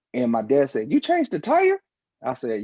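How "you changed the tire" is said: high, louder disbelieving question; father learns his young son changed the tire, high amazement; amazed dad. Retold or imitated. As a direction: shoot way up in astonished disbelief.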